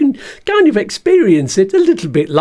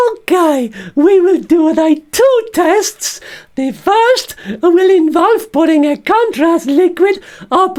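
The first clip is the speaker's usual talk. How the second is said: cheeky puppet surgeon with a much higher pitch; Bert the stoma bag as the surgeon; 9 semitones higher than usual. Pretend. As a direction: squeak it out like a little puppet doctor. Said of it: "can you be the surgeon for me".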